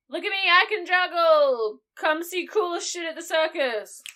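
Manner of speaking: loud, high circus crier; carnival barker. Retold or imitated. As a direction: call out to the crowd like a carnival barker. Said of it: "goes around and he's like".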